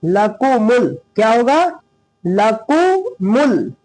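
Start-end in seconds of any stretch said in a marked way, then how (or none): 0.1-1.0 "लकुमुल" with three quick, short vowels; all syllables short. Shown as a demonstration. Teacher modelling the rule, short vowels in "लकुमुल". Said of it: "तीनों को जल्दी". 2.2-3.7 "लकुमुल" broken slowly into "लकु" and "मुल"; syllable by syllable. Shown as a demonstration. Teacher prompting the class, "लकुमुल" slowly in parts. Slow model after asking class.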